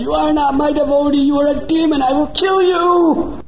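very high, loud otherworldly wrath; wrathful goddess. Acted. Goddess Chandi condemning the dacoit leader, wrathful. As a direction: thunder down as an angry deity.

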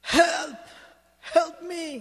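high, drawn-out call for help; loud, high-pitched acted plea for rescue; cry for help. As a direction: call out in distress from far along the path. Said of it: "he hears a voice".

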